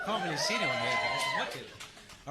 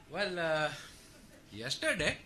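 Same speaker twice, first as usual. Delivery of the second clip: normal talking voice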